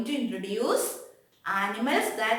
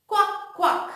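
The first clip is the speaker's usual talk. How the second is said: teacher voices the duck, higher and louder; duck quack; 8 semitones higher, 3 dB louder than usual. Shown as a demonstration. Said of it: "What is the sound of the duck?".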